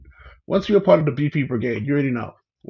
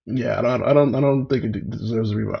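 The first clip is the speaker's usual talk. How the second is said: ordinary talk, nothing marked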